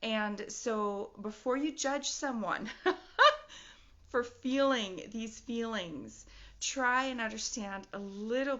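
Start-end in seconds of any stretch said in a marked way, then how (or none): 2.7-3.7 high burst of laughter; a sudden nervous laugh; real awkward laugh mid thought. Real, not acted.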